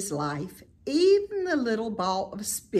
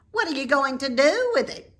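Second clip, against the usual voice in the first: higher, louder sly rat voice; Templeton; 9 semitones higher, 7 dB louder, faster than usual. A narrator's reading. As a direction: probe slyly. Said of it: "continued Templeton".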